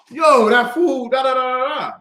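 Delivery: loud, high, stretched-out hype; excited praise. Retold or imitated. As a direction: gush slowly over her cooking. Retells praising his sister aloud.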